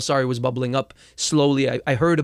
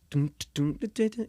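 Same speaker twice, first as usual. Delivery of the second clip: soft vocal beat, last hits higher; beatboxed rhythm; 5 semitones higher, 5 dB quieter than usual. Shown as a demonstration. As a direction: tap the beat out with the mouth. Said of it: "that's when I came up with the song".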